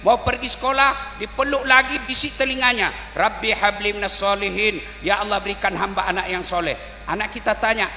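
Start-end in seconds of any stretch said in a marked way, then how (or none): none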